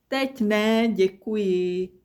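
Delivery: ordinary delivery, nothing marked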